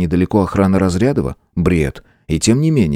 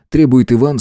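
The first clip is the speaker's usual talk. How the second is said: ordinary delivery, nothing marked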